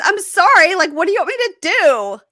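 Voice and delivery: high, loud, hammed-up sobbing plea; fake-cry it in a high voice; mock crying. Wife crying to husband from jail, high hammed-up sobbing. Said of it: "she's crying and she goes".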